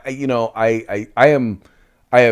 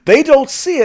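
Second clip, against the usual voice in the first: ordinary talk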